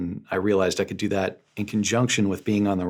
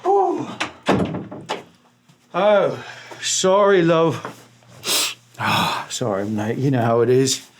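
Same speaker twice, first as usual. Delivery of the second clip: louder, higher showy star voice; put-on voice; 5 semitones higher, 3 dB louder, slower than usual. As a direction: play a pompous celebrity. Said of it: "why are you talking like that".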